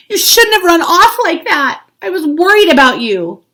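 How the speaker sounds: high, loud relieved scolding; dad calling out in the dark; worried father. A narrator's reading. Father finds runaway child.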